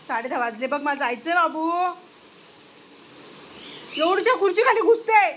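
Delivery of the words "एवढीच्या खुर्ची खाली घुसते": high, loud fussing; raised, scolding exclamation; exclaiming at pet. Real, not acted. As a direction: fuss loudly at a naughty pet. Exclaims at pet under chair.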